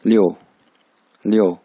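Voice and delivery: Leo cut short with a falling tone; short falling Leo, as a tone demo; fourth-tone jerk. Shown as a demonstration. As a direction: give Leo a quick downward jerk. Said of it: "झटका दे के करेंगे".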